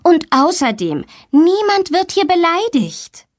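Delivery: high firm reprimand; stern teacher. A narrator's reading. Teacher reprimanding insulting boy, high firm. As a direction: lay down the rule sharply. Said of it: "ermahnt ihn die Lehrerin".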